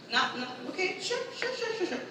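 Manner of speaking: soft, rising to high repeated assent; mock humouring agreement. Child humouring mom's excuse, mock soft high agreement.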